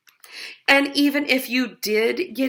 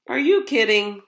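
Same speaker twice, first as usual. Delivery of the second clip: ordinary delivery, nothing marked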